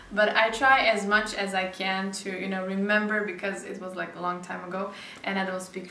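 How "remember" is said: ordinary talk, nothing marked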